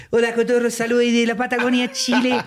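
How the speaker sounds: a sloppy imitation of a Chilean greeting; Chilean accent hammed up badly. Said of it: "ya no hagas ese acento".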